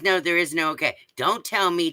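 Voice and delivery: ordinary delivery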